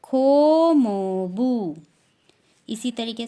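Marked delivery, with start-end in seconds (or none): 0.0-1.8 slow, clear Korean "खो मोबू", drawn out syllable by syllable; teacher modelling Korean "खो मोबू"; Korean term. Shown as a demonstration. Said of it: "तो उनको बोलते हैं".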